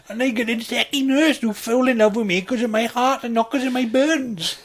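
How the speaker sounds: high, loud simpleton; hammed-up naive romantic in a daft voice; lovestruck deluded patient. Deluded patient narrates his film.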